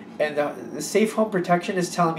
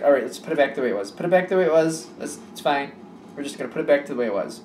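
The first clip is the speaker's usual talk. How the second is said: quick, brisk self-talk; rushed; faster than usual. Retold or imitated. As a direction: rattle it off briskly. Quoting client putting things back.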